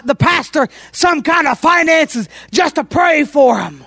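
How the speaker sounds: usual speaking voice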